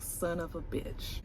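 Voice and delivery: very soft, slow cursing; muttered curse. Retold or imitated. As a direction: curse slowly under your breath in defeat. Acted curse after being snubbed.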